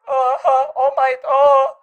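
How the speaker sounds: put-on high, loud flustered anime boy; mock anime-hero freak-out; anime kid impression. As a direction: wail like an overwhelmed anime kid, drawing out the end. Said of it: "green-haired anime kid that's like".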